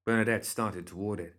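usual speaking voice

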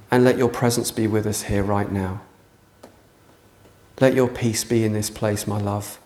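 soft, quiet tender prayer; intimate prayer. Real, not acted. Preacher praying softly over the eyes-closed congregation, tender prayer. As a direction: pray quietly into the room.

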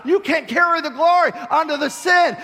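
high, loud preacher's cry; shouted preaching. Acted. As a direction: preach it full force. Preaching at full force.

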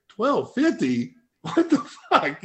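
high, drawn-out disbelief; stunned realization. Retold or imitated. Lifter realizing what was on the bar, stunned disbelief. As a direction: let it dawn, voice climbing high. All of a sudden I kinda click.

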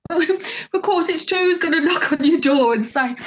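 high-pitched, laughing disbelief; incredulous retort. Retold or imitated. Incredulous retort in retold exchange.

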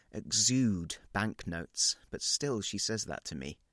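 usual speaking voice

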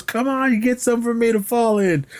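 hammed-up cop hanging from a roof; high panicked plea. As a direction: beg for help in a high strained voice. Acted quote, hanging from roof.

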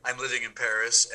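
ordinary talk

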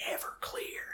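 hushed, voiceless whisper. Retold or imitated. Imitating Billy Bob Thornton with a gallon box, whispering. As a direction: whisper it very softly, drawn close. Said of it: "he whispers it".

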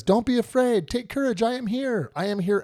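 high, insistent calls of reassurance; Jesus calling out. Retold or imitated. Jesus answering the disciples immediately, high urgent calls. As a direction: call each phrase out urgently, high in the voice. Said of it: "Then immediately Jesus says".

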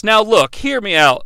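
usual speaking voice

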